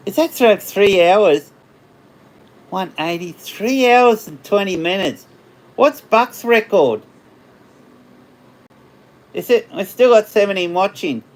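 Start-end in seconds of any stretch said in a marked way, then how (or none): none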